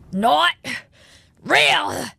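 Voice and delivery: pained, forced through clenched teeth; through gritted teeth. Acted. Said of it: "he ground out between clenched teeth".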